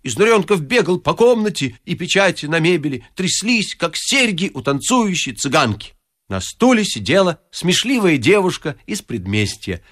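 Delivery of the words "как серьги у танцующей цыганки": plain reading voice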